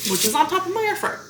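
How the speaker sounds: hiccup breaking the words. Real, not acted. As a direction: say it through a hiccup. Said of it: "Sorry, I got the hiccups".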